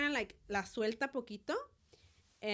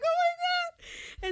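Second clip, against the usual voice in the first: very high, loud, drawn-out mock wail; imitated overwhelmed squeal; 8 dB louder, much slower than usual. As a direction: squeal it slowly, hammed-up. Acting out her overjoyed reaction.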